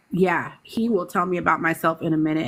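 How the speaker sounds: ordinary talk, nothing marked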